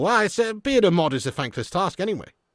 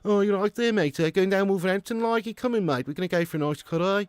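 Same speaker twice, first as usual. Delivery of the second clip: high, lilting Brummie chat; high-pitched Birmingham accent; 5 semitones higher than usual. Shown as a demonstration. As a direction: put on a broad Brummie. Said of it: "Brummie's quite decent as well".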